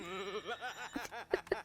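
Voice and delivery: breathless, near-silent wheezing laugh; helpless laughter. Real, not acted. Still cracking up at the scene, silent wheeze laugh. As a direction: laugh so hard almost no sound comes out. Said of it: "can't stop laughing".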